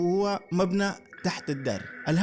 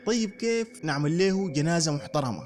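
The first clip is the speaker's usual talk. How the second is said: ordinary delivery, nothing marked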